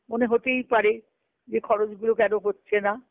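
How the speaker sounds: normal talking voice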